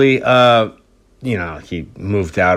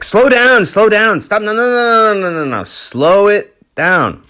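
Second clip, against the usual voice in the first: raised-voice music teacher, slowing at the end; urgent teacher correcting; 9 semitones higher than usual. Retold or imitated. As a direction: halt an overeager pupil, then stretch the last words. Teacher halting a too-fast pupil.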